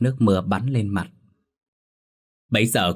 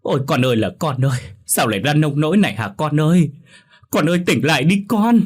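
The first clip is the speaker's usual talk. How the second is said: high, loud keening cry over her dead son; grieving mother wailing for her son; wailing lament; 7 semitones higher, 5 dB louder than usual. Acted. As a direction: wail it out, voice breaking high and loud. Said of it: "gào khóc".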